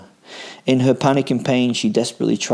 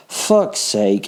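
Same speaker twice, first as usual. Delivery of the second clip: shouted. A narrator's reading. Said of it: "I shouted".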